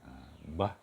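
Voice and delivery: ordinary talk, nothing marked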